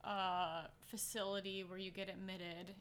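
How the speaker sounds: normal talking voice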